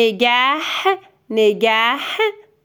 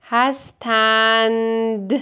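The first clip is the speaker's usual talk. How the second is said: slow dictation; slower than usual. Shown as a demonstration. Teacher dictating word by word, very slowly. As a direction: spell it out slowly with long gaps.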